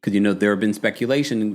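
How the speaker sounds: ordinary talk, nothing marked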